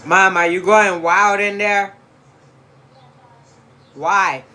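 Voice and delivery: shouting to another room; yelling across the house. Real, not acted. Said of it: "I can't keep yelling across the house".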